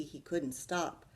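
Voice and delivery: ordinary delivery, nothing marked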